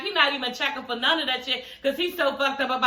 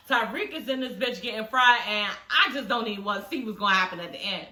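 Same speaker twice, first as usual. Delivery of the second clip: normal talking voice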